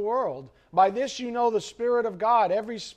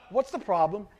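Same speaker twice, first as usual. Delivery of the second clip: normal talking voice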